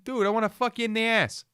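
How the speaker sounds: put-on a terrible Boston accent, high and fast; hammed-up Boston accent, pitched high; bad Boston accent. Mocking Boston couple in a bad Boston accent. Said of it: "That was a terrible Boston accent".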